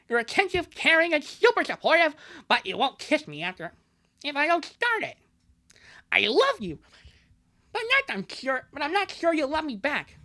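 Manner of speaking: badly faked, high-pitched cartoon whine; Bubsy. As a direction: squeal the complaint in a silly lisping cartoon voice. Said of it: "That's my Bubsy impression".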